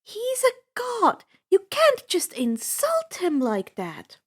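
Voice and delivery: high, indignant scolding, last line whispered; huffy Annabeth. A narrator's reading. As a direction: huff at him for insulting a god. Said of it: "She huffed".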